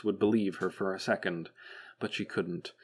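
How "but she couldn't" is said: plain reading voice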